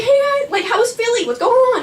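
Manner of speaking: very high, chirpy hello; eager greeting. Retold or imitated. Retold eager greeting to Nick.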